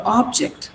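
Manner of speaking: full o, weight on OB in object; first-syllable stress. Shown as a demonstration. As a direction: object as OB-ject, softly. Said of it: "Here it is or".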